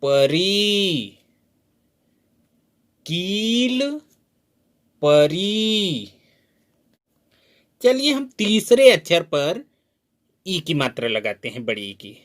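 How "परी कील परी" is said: परी very slowly with the final ई held long; slow drawn-out परी for pupils. Shown as a demonstration. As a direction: stretch the री in परी. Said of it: "दूसरे अक्षर को हम लोग देर तक पढ़ेंगे".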